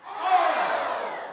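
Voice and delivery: high, loud, peppy cheer; squealing cheerleader "Oh!". Pretend. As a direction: shout it like a pumped-up cheerleader. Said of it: "as if you're a cheerleader".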